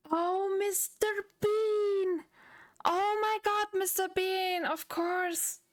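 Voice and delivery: sudden high, drawn-out "oh, Mister Bean!" of discovery; light up as the answer finally clicks; excited realization. Real, not acted.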